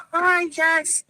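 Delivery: normal talking voice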